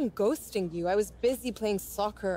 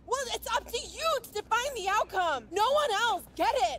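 heated retort; 13 semitones higher than usual. Acted. Ellie arguing back sharply.

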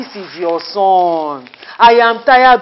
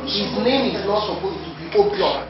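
quiet seething repeat of the line; muttered repeat; 7 dB quieter than usual. Acted. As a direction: grumble His name is not supposed to be Obiora under your breath. Same insult repeated under her breath.

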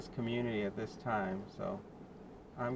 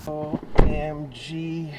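slow, drawn-out, louder letters; exasperated OMG; 9 dB louder, much slower than usual. Real, not acted. Driver finally drops off the order, drawn-out exasperated groan. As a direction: stretch each letter out in exasperation. Relief after painful delivery.

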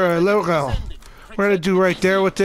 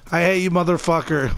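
ordinary delivery

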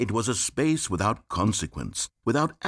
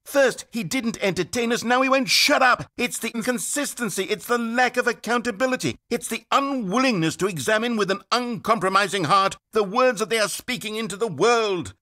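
mocking complainer; 9 semitones higher than usual. Narrator mimics reviewers complaining, high whiny sneer. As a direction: mimic a petty critic with scorn. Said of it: "they said".

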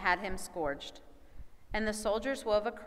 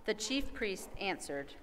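usual speaking voice

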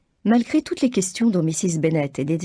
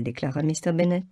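ordinary talk

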